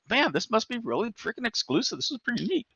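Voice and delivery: high, soft, impressed musing; retold naive awe. Retold naive awe while waiting.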